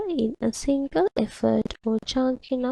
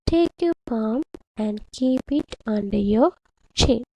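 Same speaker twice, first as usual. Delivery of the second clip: usual speaking voice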